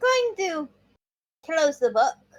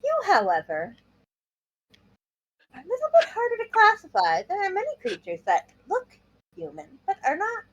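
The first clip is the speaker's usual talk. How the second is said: Nezu in character; 5 semitones higher than usual. Acted. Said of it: "he'll look to Sakauchi and say".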